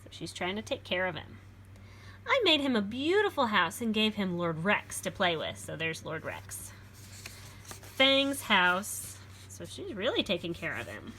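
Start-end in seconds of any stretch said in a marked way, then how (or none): 2.3-5.6 put-on lively read-aloud for children; storybook reading. A narrator's reading. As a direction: read it like a picture book to a class. Reads picture book aloud.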